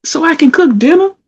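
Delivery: high, loud irritated rant; worked-up retelling of being mad; getting mad. Retold or imitated. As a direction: let the annoyance climb high and loud. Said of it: "found myself getting kinda mad".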